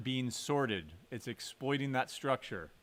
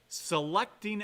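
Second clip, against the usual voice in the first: "selecting" drawn out slowly, pitched higher; slow, raised emphasis on "selecting"; stress on selecting; 7 semitones higher, 5 dB louder, slower than usual. Shown as a demonstration. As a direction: lean hard on the word "selecting". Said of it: "Note the emphasis on the word there".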